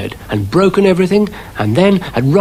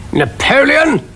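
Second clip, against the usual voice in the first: high, indignant cannon voice; booming cannon; 14 semitones higher, slower than usual. A narrator's reading. Cannon offended at the Napoleon claim, booming. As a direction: boom out the name in outrage. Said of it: "it boomed".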